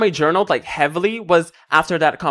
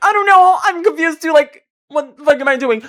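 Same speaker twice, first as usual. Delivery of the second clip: high frantic confusion; 11 semitones higher, 6 dB louder than usual. Retold or imitated. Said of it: "and I'm like".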